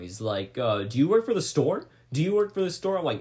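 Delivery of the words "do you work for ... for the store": higher, pushier repeated question; older guy confronts the narrator, mock aggressive; mock aggressive demand. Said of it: "he's starting to get aggressive now".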